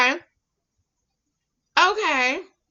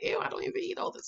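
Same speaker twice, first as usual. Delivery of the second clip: whispered, fast, disgusted "Ew"; mock the shopper's regret under your breath; 7 semitones higher, 15 dB quieter, faster than usual. A regretful shopper back home, mock disgusted whisper. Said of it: "you come home like".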